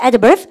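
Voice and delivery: adverb modelled with stress on AD. Shown as a demonstration. Teacher prompts class to repeat, adverb firmly stressed. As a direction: say adverb for repeating, first syllable punched. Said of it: "발음할까?".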